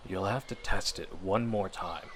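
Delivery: ordinary talk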